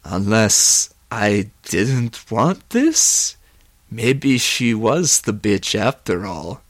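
slow, halting realization; drawn-out, wondering turn of mind; dawning doubt. Acted. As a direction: draw it out, pausing between words. Johnny's remorse turns to doubt.

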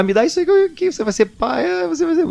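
hammed-up sister bursting into tears; imitating crying sister. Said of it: "já começou a chorar". (irmã chorando de emoção, voz bem aguda e chorosa imitada)

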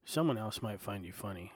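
normal talking voice

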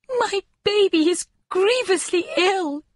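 a distraught mother near tears; tearful young mother. A narrator's reading. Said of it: "sobbing as though her heart would break".

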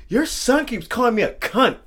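high, loud imitated tattling; complaining girlfriend. Girlfriend telling on him to his mom, imitated complaint. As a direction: whine it up high, outraged. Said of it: "She went up to my mom".

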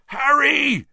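high, loud anguished yell across the pitch. Acted. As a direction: yell his name in anguish from the goalposts. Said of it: "Wood's anguished yell".

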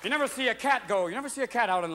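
usual speaking voice

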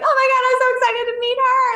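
very high, fast, thrilled gush; high-pitched rapid delight; giddy squeal. Retold or imitated. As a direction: squeal it like an overjoyed new mom. Retold reaction at C-section.

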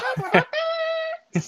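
high vocal trumpet imitation; silly little mouth-trumpet; trumpet sound. Shown as a demonstration. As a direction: toot like a trumpet with your voice. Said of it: "Okay, more trumpet sound".

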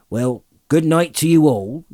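loud, hearty rustic farewell; Maggot. A narrator's reading. Farmer leaving the hobbits, hearty.

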